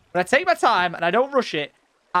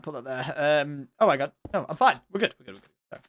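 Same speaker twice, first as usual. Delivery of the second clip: ordinary talk, nothing marked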